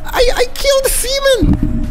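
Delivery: very high, squealing cries of horror; panicked wail. Real, not acted. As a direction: wail as if you did something terrible. Distraught at fouling the goalkeeper.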